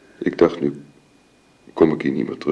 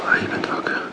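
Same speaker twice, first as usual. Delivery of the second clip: sickbed whisper. Acted. Woman whispers to her dying friend as she wakes. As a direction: hush at a sick friend's bedside.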